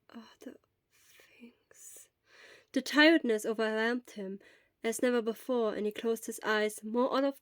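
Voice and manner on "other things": drifting off into an unvoiced whisper; fading whisper. A narrator's reading. Shota drifting to sleep, fading to a whisper. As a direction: let the words fade into sleep.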